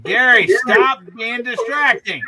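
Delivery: exasperated call to order; scolding. Real, not acted. Scolding Gary for distracting her.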